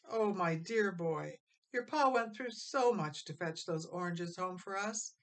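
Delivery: plain reading voice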